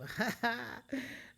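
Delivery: bright burst of laughter; high laugh. Real, not acted. Laughs at party in mouth.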